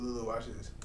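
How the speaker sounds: ordinary delivery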